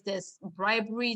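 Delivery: normal talking voice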